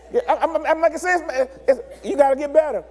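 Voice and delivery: normal talking voice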